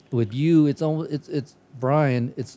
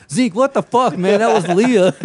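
imitating an outraged friend, much higher and louder; mock outraged yell; 10 semitones higher, 9 dB louder than usual. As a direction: yell it in hammed-up disbelief, breaking into a laugh. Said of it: "And then he goes".

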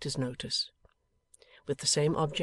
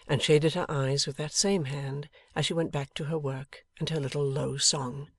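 usual speaking voice

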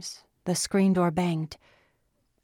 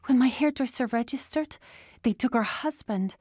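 wavering voice; 7 semitones higher than usual. Acted. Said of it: "Anna's voice wavered".

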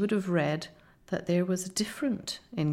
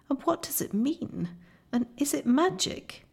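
high, bewildered questions; distressed cry; 7 semitones higher than usual. A narrator's reading. Susan at the broken table, distressed questioning cry. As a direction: ask in dismay, pitch climbing on each question. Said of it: "cried Susan".

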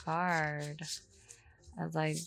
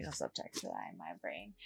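whispering; 11 semitones lower, 12 dB quieter than usual. Real, not acted. Whispered aside during reading.

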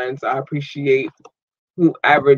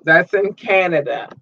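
ordinary talk

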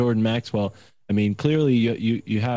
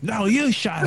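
squabbling puppet voice; 10 semitones higher than usual. Retold or imitated. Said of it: "two guys that argue in that booth".

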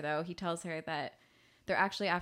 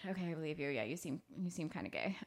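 quiet Martin; 3 semitones lower, 8 dB quieter than usual. Retold or imitated. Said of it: "Martin's just like".

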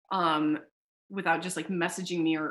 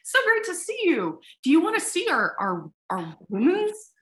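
much higher and louder, gushing salesman; mocking eager booth guys; 11 semitones higher, 6 dB louder than usual. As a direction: ham up the overexcited vendor. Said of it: "They're like".

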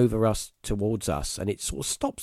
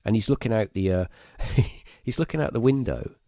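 normal talking voice

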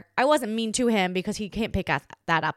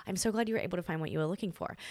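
said very nicely; 3 semitones lower, 7 dB quieter, much faster than usual. Retold or imitated. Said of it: "I said it very nicely".

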